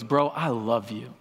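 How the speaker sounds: soft, low, unhurried brotherly assurance; friend's tender reassurance. Retold or imitated. Friend Anthony comforting the ashamed speaker: low, slow and tender. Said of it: "just looks at me and he goes".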